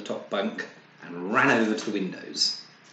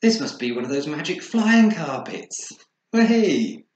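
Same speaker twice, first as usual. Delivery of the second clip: amazed excitement; 8 semitones higher, 11 dB louder than usual. Acted. Amazed on flying carpet.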